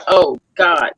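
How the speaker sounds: usual speaking voice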